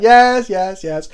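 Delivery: dismissive assent. Retold or imitated. French opponent waving off the question, retold dismissive assent. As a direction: imitate an opponent brushing it off.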